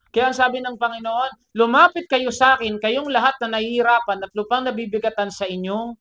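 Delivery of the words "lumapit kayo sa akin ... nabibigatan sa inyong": raised, declaiming quote; proclaiming scripture. Retold or imitated. Preacher quoting Jesus' invitation to the burdened, proclaiming. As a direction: proclaim it out to the room. Reads Jesus' words aloud forcefully.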